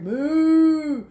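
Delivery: loud drawn-out moo; cow mooing. Acted. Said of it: "who muttered".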